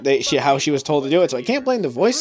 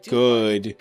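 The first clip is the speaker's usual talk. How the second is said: mock Ryo; 8 semitones lower than usual. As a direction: drop it low and terse like the game hero. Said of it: "We don't go".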